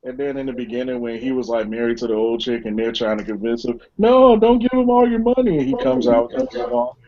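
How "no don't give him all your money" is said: usual speaking voice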